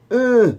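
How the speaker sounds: high, loud wordless droning noise; Stephen Hawkins impression. Joker showing off his party-piece impression, loud high drone. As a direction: let out one loud, high-pitched drone. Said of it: "do you see my impression of Stephen Hawkins".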